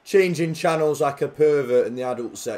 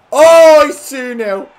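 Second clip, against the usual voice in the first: high, loud cry of joy; delighted goal cry; excited outburst; 11 semitones higher, 13 dB louder, slower than usual. Real, not acted. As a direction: burst out at the goal. Celebrating second goal.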